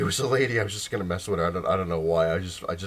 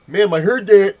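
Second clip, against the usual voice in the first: loud, high, garbled mock-deaf voice; faked deaf speaker; 10 semitones higher, 12 dB louder than usual. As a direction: exaggerate slurred consonants as if unable to hear yourself. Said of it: "I'm deaf, not stupid".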